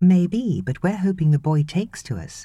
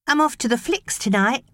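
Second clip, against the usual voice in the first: put-on chirpy teenage girl; 11 semitones higher than usual. Teen babysitter Maureen voice.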